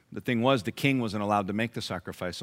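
ordinary talk, nothing marked

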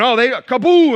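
high, loud cartoon smack on kaboom; gleeful mock strike with scripture; mock Bible-thumping attack. Preacher mocking believers who smite others, hammed-up loud kaboom.

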